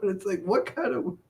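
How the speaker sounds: usual speaking voice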